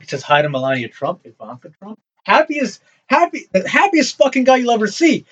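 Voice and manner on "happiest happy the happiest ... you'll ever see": high, excited rush of words. Real, not acted. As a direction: say it with delighted glee. Gleeful description of the Pope.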